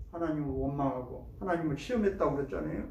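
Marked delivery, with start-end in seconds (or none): none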